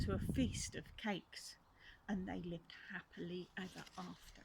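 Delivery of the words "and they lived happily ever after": whisper. Acted. Whispered ending.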